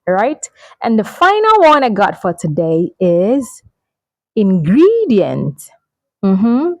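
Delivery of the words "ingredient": "ingredient" as in-GREE-dee-ent, drawn out slowly; slow model "ingredient", stretched middle; second-syllable stress. Shown as a demonstration. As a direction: lean long on GREE in "ingredient". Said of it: "the stress is on the second syllable".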